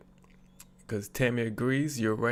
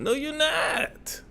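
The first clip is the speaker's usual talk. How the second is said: much higher, slow, drawn-out "No, you're not."; incredulous no; 14 semitones higher, much slower than usual. Real, not acted. Commentator answers the woman's promise to stay calm, drawn-out disbelief. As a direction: stretch "not" in flat disbelief.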